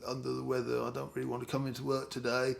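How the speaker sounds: mock sick voice. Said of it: "rang up your boss and said".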